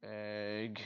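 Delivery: ordinary talk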